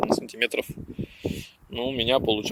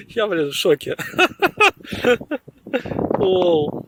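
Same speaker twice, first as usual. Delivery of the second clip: laughing; 9 semitones higher, slower than usual. Real, not acted. Laughing at the find.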